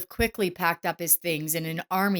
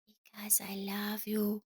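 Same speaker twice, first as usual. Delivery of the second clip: soft, slow breathy whisper; 3 semitones higher, 9 dB quieter than usual. A narrator's reading. Boy whispers love into father's ear. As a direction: whisper it close in his ear. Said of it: "he whispered hotly".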